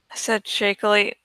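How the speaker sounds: ordinary delivery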